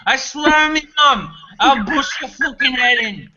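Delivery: hammed-up Irish hard man; Irish accent. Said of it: "motherfucking Irish accent".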